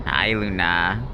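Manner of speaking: slow, low, tender hello to the cat; drawn-out coo for the cat; cat greeting. Real, not acted. Streamer greets his cat Luna, slow and tender.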